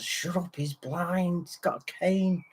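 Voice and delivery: soft, urgent mock hush; retold hushing. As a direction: imitate a friend quietly warning someone off. Acts friend hushing the stranger.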